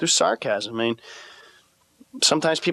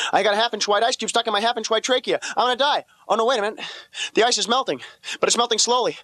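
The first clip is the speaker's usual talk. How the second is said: higher, hurried mock panic while choking; panicky inner math; 8 semitones higher than usual. Choking on an ice cube, doing frantic math in mock panic. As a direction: think it through in rising mock alarm. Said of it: "I'm doing this panicky math equation".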